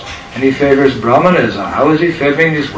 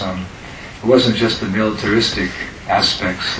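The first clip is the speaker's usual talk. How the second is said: ordinary talk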